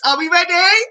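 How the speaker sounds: put-on high, bright call to the kids; rally them up before dancing; excited. Presenter hyping kids for a dance break, excited call.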